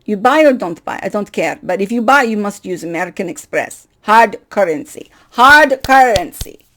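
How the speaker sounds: a mock blunt Russian clerk laying down the rule; classical Russian clerk. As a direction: imitate a curt Soviet office worker. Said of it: "of course she's classical Russian".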